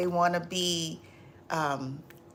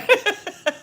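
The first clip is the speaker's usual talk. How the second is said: laughing; 13 semitones higher, 7 dB louder than usual. Real, not acted.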